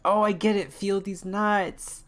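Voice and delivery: loud, high excited yell; excited shout. Real, not acted. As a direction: burst out with delight. Sudden excited outburst.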